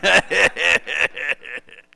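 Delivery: loud imitated hearty laugh. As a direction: laugh it up at the poor kid. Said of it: "He's just laughing".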